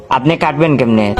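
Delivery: usual speaking voice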